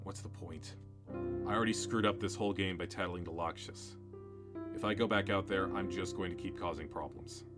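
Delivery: soft, low defeated voice; despondent. Acted. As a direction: give up quietly. Despairing host offstage.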